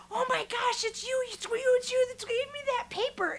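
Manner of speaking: high, gushing, tumbling repeats; excited recognition. Retold or imitated. Shuttle driver recognizes the passenger she likes, excited. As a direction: act out a thrilled driver, high and loud.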